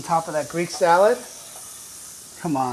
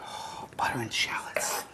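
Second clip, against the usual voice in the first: soft, slow whisper over the pan; savoring whisper; 7 semitones lower, 13 dB quieter, slower than usual. Real, not acted. Hosting a cooking show for kids, savoring the smell of butter and shallots in a hushed whisper.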